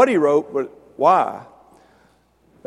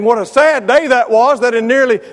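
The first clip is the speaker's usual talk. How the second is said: raised, higher voice in mock dismay; higher and louder mock lament; Hardiman's retort; 7 semitones higher, 5 dB louder than usual. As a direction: lament it loudly, tongue in cheek. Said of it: "Brother Hardiman replied by saying".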